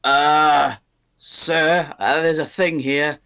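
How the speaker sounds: guard stammering a warning to his boss; startled guard. Acted. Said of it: "He's like".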